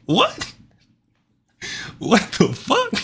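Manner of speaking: very high squeal of amused shock; incredulous disbelief. Real, not acted. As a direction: squeak it out, floored by what you heard. Reacting to the replayed clip.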